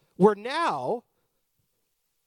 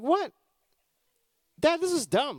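put-on high-pitched incredulous child; acted high whine of disbelief; protesting kid; 9 semitones higher, 4 dB louder than usual. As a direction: object like a kid appalled by dad's plan. Child objects to dad's church plan.

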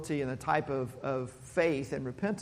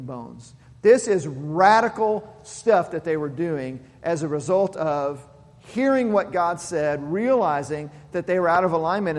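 louder, driving preacher's list; building preaching; 5 dB louder than usual. Acted. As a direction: hammer each reform home from the pulpit.

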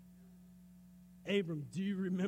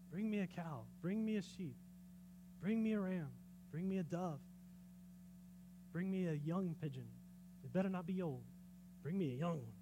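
quiet listing; 4 semitones lower, 10 dB quieter than usual. Retold or imitated. God's demands retold quietly.

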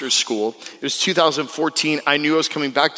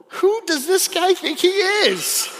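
slow, pitched-up mock outrage; mock indignant inner voice; slower than usual. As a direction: play up the offended disbelief. Indignant reaction to pushy caller.

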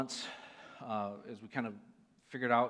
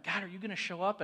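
retold plea to God; 8 semitones higher, 3 dB louder than usual. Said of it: "I'm like".

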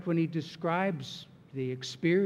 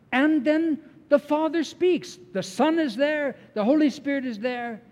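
higher and louder, building preacher's fervor; rising preaching fervor; 8 semitones higher, 5 dB louder than usual. Acted. Pastor stirring his congregation, higher and louder. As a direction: lift it like a proclamation.

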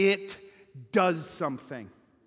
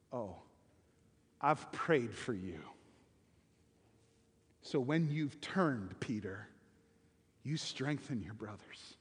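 tender reassurance; 7 semitones lower, 7 dB quieter than usual. Acted. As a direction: speak it like a gentle promise to a friend. Jesus reassuring Peter.

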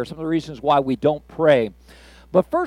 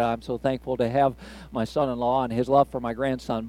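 softer, voice catching with real emotion; choked up; 4 semitones lower, 6 dB quieter than usual. Real, not acted. Grandpa moved by his son-in-law's love for his grandson, choked up. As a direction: hold back tears as you speak. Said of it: "I'm actually getting a little choked up".

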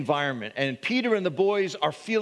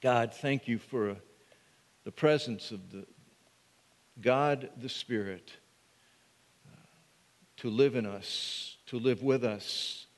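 prayer; 7 semitones lower, 5 dB quieter than usual. Acted. Pastor praying to God before preaching, hushed and low. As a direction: pray quietly and reverently before the sermon.

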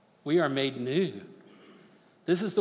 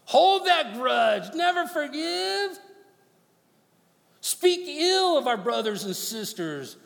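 higher, slow tempting voice of the flesh; 10 semitones higher, 3 dB louder, slower than usual. Acted. Preacher voicing his flesh urging grudges, slow and high. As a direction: play the flesh egging him on, slow and high. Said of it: "my flesh is sitting there going".

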